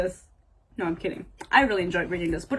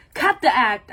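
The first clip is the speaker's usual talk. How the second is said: loud, punchy acted order; confrontational; 6 dB louder than usual. Heroine calling out her partner's act, confrontational. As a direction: call out his act sharply. Acting out an angry confrontation from book.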